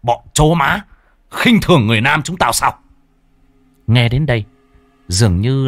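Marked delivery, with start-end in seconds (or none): 0.0-2.8 angry shout. A narrator's reading. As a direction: bellow the insult in rage. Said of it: "người kia quát lớn".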